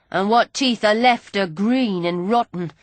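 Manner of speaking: ordinary delivery, nothing marked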